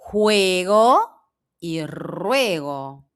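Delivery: juego with a throaty J, ruego slowly with a trilled R; slow minimal pair juego ruego; contrasting J and R. Shown as a demonstration. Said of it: "se você não pronunciar bem o R".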